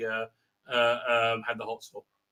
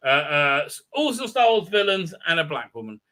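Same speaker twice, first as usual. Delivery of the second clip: ordinary delivery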